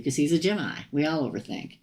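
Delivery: ordinary talk, nothing marked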